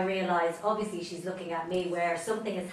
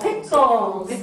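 eager correct answer; 6 semitones higher, 12 dB louder than usual. Real, not acted. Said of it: "That's it".

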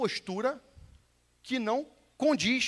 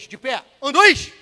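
shouted drill command; 5 semitones higher, 14 dB louder, slower than usual. Shown as a demonstration. Instructor demands more energy from the class, shouted drill command. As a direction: order building into a high, loud count. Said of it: "Vamos lá mais uma vez".

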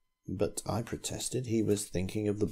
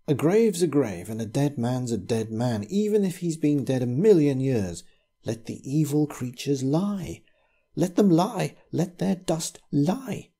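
put-on louder, higher, furious old man; rising anger; 5 semitones higher, 4 dB louder than usual. A narrator's reading. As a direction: let the hatred burst out. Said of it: "His anger rose".

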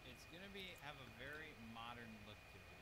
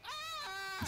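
high loud startled yelp; startled scream; 12 dB louder than usual. Real, not acted. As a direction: shriek in sudden fright. Sudden scare in game.

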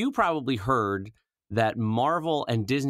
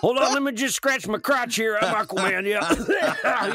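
Southern trucker Aquaman; 11 semitones higher, 4 dB louder than usual. Retold or imitated. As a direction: ham up a crude trucker Aquaman. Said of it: "the voice of a, of a, a Southern trucker".

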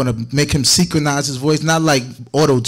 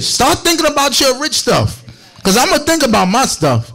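excited; 9 semitones higher than usual. Real, not acted. Hyping congregation about riches.

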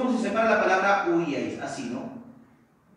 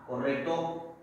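normal talking voice

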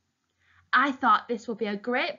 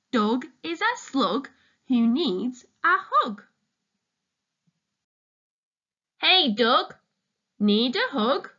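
put-on reading to children. Reading picture book to children.

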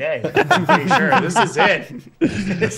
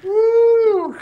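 high loud whoop; excited whoop; 7 dB louder than usual. Real, not acted. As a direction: let out a big excited whoop. Reacting to the spicy bite.

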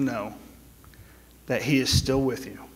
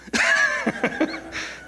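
laughing. Real, not acted. Pastor laughing at his own story.

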